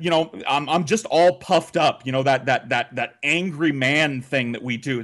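ordinary talk

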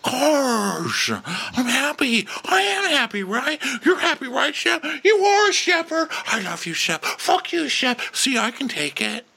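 high-pitched mock whine; hammed-up Shep rant. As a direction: squeal it out like a put-upon man venting alone. Mimics Shep ranting to himself.

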